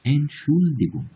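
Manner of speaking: Entschuldigung stretched out, syllable by syllable; slow German demo. Shown as a demonstration. Teacher repeating the German take for students, slow and careful. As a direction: say Entschuldigung slowly, like a model for learners. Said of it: "German উচ্চারণটা দেখেন কত সুন্দর".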